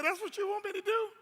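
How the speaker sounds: a monkey who can't believe it's that easy; surprised monkey. Acted.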